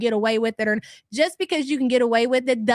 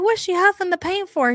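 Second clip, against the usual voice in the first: put-on puzzled child; 8 semitones higher than usual. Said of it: "I'm like".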